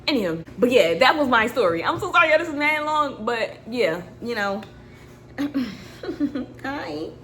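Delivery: ordinary talk, nothing marked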